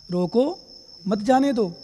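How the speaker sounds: "रोको", a clear break, then "मत जाने दो"; pause after रोको. Shown as a demonstration. Monk showing what he really ordered, pause after "रोको". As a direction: set "रोको" apart as its own command. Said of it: "और क्या सुन लिया उसने?".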